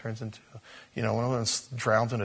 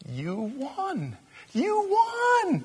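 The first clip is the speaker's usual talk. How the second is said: choked up, near tears; much slower than usual. Real, not acted. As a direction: overcome with emotion, building to a squeal. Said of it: "I wanna cry now".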